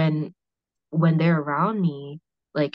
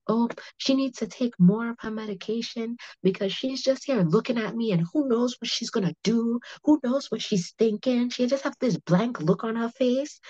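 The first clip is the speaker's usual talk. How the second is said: higher-pitched imitation of an alarmed complainer; mock fretful tattling, high voice; complaining relative; 7 semitones higher than usual. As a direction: mimic her griping to mom, pitched up. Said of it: "she told my mom, she was like".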